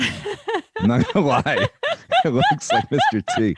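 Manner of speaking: laughing through the words; slow, giggling admission. Real, not acted. Host laughing at the coconut gift, amused.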